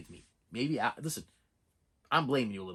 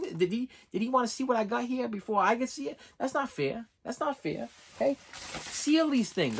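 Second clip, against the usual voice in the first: indignant complaint; 10 semitones higher, 3 dB louder than usual. Real, not acted. Complaining to camera about package.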